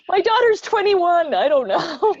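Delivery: high, loud, amused outburst; laughing protest. Real, not acted. Guest excuses herself to interviewer over the dwarfs question, laughing protest.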